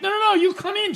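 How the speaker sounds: mock pushy shopkeeper. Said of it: "She's like".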